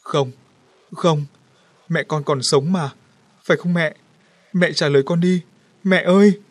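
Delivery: crying out, rising to a loud wail; bursting into tears. A narrator's reading. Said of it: "Liễu òa khóc". (òa khóc gọi mẹ, càng lúc càng to)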